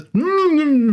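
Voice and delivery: high muffled mumbling through a mask; Pyro mumble. Retold or imitated. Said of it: "All he does is".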